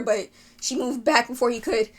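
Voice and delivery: ordinary talk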